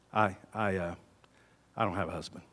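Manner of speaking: low, soft, halting confession; woman ashamed, answering Jesus quietly; ashamed admission. Acted. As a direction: drop the voice, faltering in shame. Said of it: "her countenance just falls".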